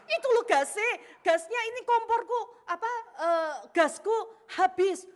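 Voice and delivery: high, loud, frantic explaining; flustered urgency. Retold or imitated. Wife tells husband the stove gas ran out, flustered.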